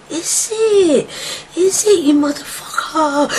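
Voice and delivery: cracking angry yell; angry outburst. Retold or imitated. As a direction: spit it out at them, enraged. Quoting himself in a fight.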